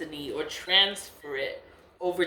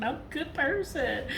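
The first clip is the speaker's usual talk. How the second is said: higher mocking voice, sing-song; mocking the spiritualist; 9 semitones higher than usual. Vlogger mimicking the spiritualist's claim, higher mocking voice. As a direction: mimic his fake piety, higher. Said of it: "I don't know how many times he said that".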